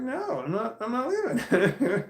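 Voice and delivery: high, drawn-out refusal breaking into a laugh; high-pitched mock protest; amused mock refusal. Acting friend's refusal, breaking into laughter.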